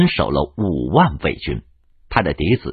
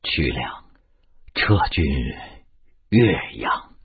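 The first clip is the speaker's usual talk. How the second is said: low, hushed command with long pauses; low weak voice; 8 semitones lower, 6 dB quieter than usual. A narrator's reading. Wounded duke on his cot orders a withdrawal, in a low voice. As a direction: speak softly and low, word by word. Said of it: "低声地说".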